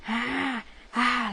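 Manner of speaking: high strained "ah, ah" cries; father's anguished cries. Retold or imitated. Father pulling his hair, crying out in anguish. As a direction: imitate a man tearing his hair out. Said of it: "like that, and he was just pulling his hair".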